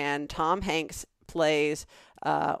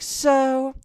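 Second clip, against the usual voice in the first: higher, slower contractor on the phone; 7 semitones higher, 5 dB louder, much slower than usual. Retold or imitated. Contractor telling her about the truck, in a higher, slower put-on voice. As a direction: play the contractor calling with news. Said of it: "He's like".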